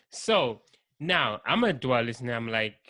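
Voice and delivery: normal talking voice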